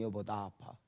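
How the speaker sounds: barely audible, soft and weak; faint wife's voice. Retold or imitated. Sick wife tells her husband, soft and weak.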